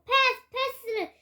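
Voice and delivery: put-on loud, high child's call; shouting for the ball. A narrator's reading. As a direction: yell for a pass across the field. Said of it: "연신 외치지만".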